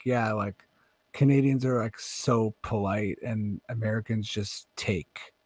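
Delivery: low, slow, deadpan statement of fact; matter of fact. Retold or imitated. Friend explaining Canadians versus Americans, slow and deadpan. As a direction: lay it out flatly, like an obvious truth. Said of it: "it was very matter of factly".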